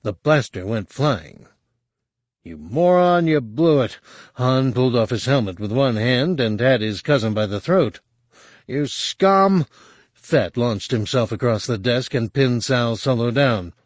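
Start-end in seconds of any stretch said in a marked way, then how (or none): 2.4-4.0 angry shout. Acted. Sal Solo yells at Han. 8.7-9.7 higher, furious yell; furious shout. Acted. Han attacks his cousin.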